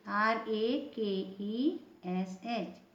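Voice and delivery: letters spelled out slowly one by one; slow spelling. Shown as a demonstration. Teacher spelling a word slowly for students. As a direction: dictate each letter slowly for the class. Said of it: "teacher spells out letters".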